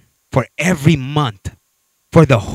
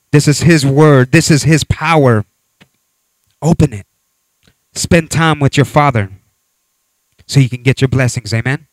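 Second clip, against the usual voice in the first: louder, emphatic preacher's exhortation; preaching; 4 dB louder than usual. Acted. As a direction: drive the word home to the congregation. Pastor exhorts the congregation.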